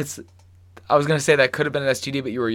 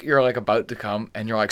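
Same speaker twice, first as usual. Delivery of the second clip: normal talking voice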